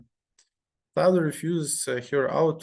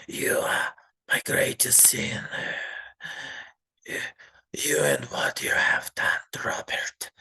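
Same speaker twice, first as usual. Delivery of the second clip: put-on sinister old man whisper. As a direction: hiss out your sins like a man losing his mind. Grandfather's menacing whispered confession.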